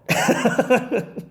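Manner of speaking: short high laugh; amused laugh. Real, not acted. Host laughing at Vance's reply to critic, high laugh. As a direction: laugh at the blunt comeback.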